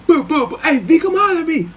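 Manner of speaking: high-pitched mock holler; hollering at the door. Neighbor banging on the cell door, high holler. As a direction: imitate banging, then call out high and urgent. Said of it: "instead of knocking on the door like".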